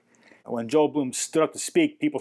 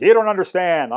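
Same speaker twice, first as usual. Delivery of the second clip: raised, higher retort imitating an irritated friend; mock exasperation; 6 semitones higher, 7 dB louder than usual. Short-tempered shop owner to the narrator, imitated exasperation. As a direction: push back louder, as a short-tempered man.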